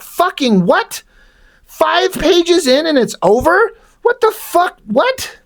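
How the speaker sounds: high-pitched, drawn-out disbelief; retold incredulity. Reader finding the comic over after five pages, mock incredulous. Retells disbelief at abrupt ending.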